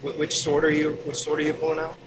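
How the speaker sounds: ordinary talk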